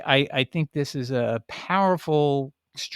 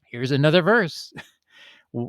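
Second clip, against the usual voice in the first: ordinary talk, nothing marked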